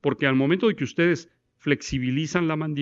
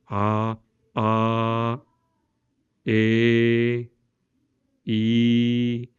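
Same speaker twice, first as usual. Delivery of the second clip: vowel exercise; 3 semitones lower, much slower than usual. Shown as a demonstration. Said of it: "la posición correcta de la vocal".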